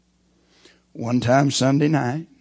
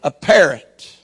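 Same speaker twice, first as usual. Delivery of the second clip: brisk, raised, punchy storytelling; lively joke telling; 6 semitones higher, 10 dB louder than usual. Acted. Preacher warming up the congregation with a lively joke. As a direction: sell the parrot joke with energy and higher pitch.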